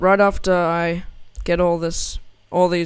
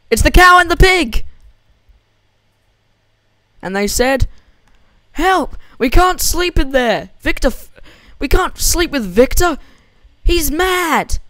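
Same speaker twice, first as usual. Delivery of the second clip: high, loud panicked cries for help; squealing pig and cow begging to be let in; panicked animals; 8 semitones higher, 6 dB louder than usual. Acted. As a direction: shout it like a frightened farm animal.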